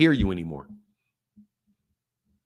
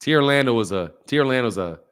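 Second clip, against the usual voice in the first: low, drawn-down mocking voice; hammed-up low-pitched grumbling detractor; mock critic; 9 semitones lower than usual. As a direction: parrot the haters in a much lower voice. Mockingly voicing his critics' insults.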